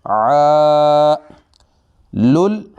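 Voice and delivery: आ held long for one alif length; long drawn aa. Shown as a demonstration. Teacher drilling letters, holding the vowel long. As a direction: stretch the आ out. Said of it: "आइन को एक अलिफ की मिकदार लंबा".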